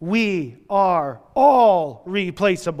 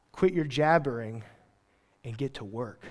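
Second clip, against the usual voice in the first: dry gruff God; 7 semitones lower, 10 dB quieter than usual. Retold or imitated. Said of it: "and he's like".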